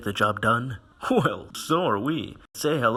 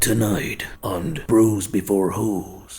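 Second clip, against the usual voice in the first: slow, hushed announcer whisper; trailer whisper; 4 semitones lower, slower than usual. Acted. A show intro, said as a trailer.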